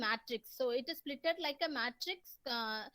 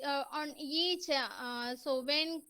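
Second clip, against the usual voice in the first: normal talking voice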